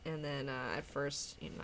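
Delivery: ordinary talk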